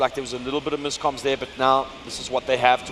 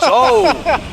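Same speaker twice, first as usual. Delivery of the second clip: surprised shout; 11 dB louder than usual. Real, not acted. Caster reacts to surprise kill.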